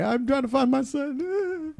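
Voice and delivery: high, slow, soft cartoon fish dad; Marlin impression. Friend quoting Finding Nemo's Marlin, high and slow. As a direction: stretch it out like a worried fish. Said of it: "he's like".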